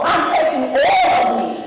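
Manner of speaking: with conviction. Shown as a demonstration. Speaker rallies graduates to bring it home, with conviction. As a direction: lead the chant with full conviction. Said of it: "say that with conviction".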